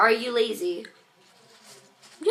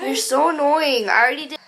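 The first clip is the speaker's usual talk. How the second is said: louder, higher, fed up with sister; annoyed; 4 semitones higher, 8 dB louder, faster than usual. Real, not acted. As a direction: snap back at a pesky sister. Snapping at sister.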